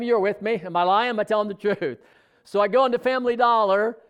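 ordinary talk

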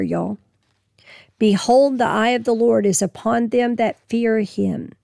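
usual speaking voice

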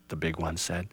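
ordinary delivery, nothing marked

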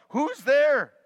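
high, loud frightened cry; terrified call. Acted. Burglar calls into the dark, terrified and loud. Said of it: "the burglar calls out".

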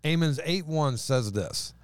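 usual speaking voice

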